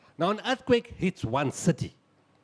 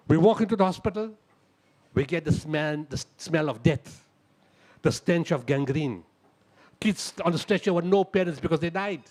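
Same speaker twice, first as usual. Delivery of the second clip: normal talking voice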